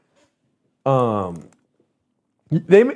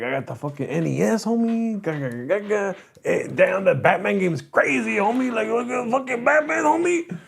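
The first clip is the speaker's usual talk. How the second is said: excited homeboy impression, climbing high on "homie"; softer, pitched-up mock cousin showing off games; Cesar; 7 semitones higher, 4 dB quieter than usual. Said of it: "He's like".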